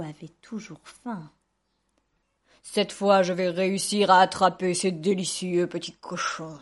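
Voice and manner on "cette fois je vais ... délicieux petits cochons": loud, low storybook wolf; growing relish of a hungry wolf; scheming wolf. A narrator's reading. Hungry wolf to himself about the pigs, scheming.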